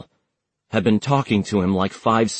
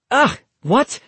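high, baffled yelp; clueless surprise; 14 semitones higher, 3 dB louder than usual. A narrator's reading. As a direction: caught totally off guard. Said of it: "clearly clueless".

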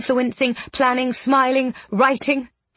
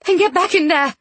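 higher-pitched impatient command; exasperated scolding; 7 semitones higher, 3 dB louder than usual. A narrator's reading. Miss Eyre ordering a ghost into her body, exasperated. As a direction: order sharply back to work.

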